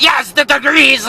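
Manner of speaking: put-on high, accented outburst; mock exasperation. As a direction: burst out as if fed up at being misunderstood. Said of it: "pissed off now".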